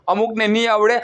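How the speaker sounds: ordinary delivery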